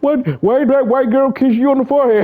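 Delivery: put-on squeaky little boy pleading; high-pitched child talking to his dad; high kid voice. Little boy pleading with Pop, high squeaky voice.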